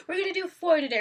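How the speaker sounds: high, loud imitation of an eager promise; mock enthusiasm. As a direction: mimic an overconfident co-host. Mimics co-host's overconfident promise.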